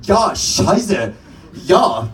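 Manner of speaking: lightly voiced German shoe-waving drunk; mock German. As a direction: bark it like a lost tourist faking German. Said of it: "circling around going".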